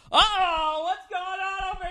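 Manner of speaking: high, booming sportscaster call; nosy announcer. Retold or imitated. The loud guy ten feet away calling out like an announcer. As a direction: shout it across the room like a TV announcer. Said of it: "just goes like".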